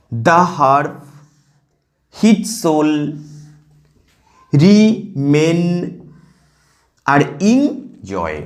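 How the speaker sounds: two-syllable chunks with long pauses; segmented, deliberate verse reading; reading in pairs. Shown as a demonstration. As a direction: scan it slowly, foot by foot. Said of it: "দুটো দুটোকে নিয়ে জোর করবে".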